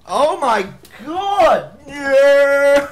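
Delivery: high, loud delighted whoop with laughter; real burst of joy at the lucky hit; cheering and laughing out loud; excited laughing cheer. Real, not acted. Sudden win in speedrun.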